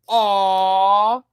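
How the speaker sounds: long, loud, high mock aw. Mocking people cooing at a dog's face, loud high aw. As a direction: coo at the dog too hard. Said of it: "They say".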